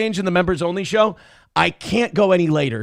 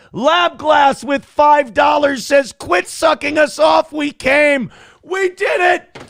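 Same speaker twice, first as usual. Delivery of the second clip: excited shout; 8 semitones higher, slower than usual. Real, not acted. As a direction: whoop it up like a win. Celebrating a perfect donation week.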